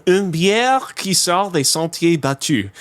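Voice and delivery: French label read aloud. Real, not acted. Podcaster reads a beer label all in French, French pronunciation. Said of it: "this is all in French".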